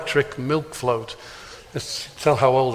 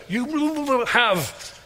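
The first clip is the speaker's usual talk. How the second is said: flustered babble; 10 semitones higher, slower than usual. Retold or imitated. Frank's upset babbling reply.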